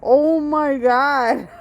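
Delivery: high, loud shocked outburst; shocked disbelief. Real, not acted. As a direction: gasp at all that pepper. Shocked at pepper amount.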